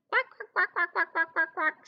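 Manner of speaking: put-on high, quick baby duck quacks; high-pitched duckling chatter; little ducklings quacking. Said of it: "says the little ducklings".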